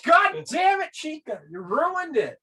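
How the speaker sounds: put-on high, frustrated girl's groan of defeat; exasperated anime girl. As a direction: whine it out like a foiled schemer. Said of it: "and she's like".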